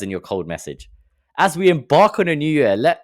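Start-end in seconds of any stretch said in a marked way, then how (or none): 1.4-3.0 higher, louder ceremonial opener; mock grand announcement. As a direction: boom it out like a corporate greeting. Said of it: "don't use these words".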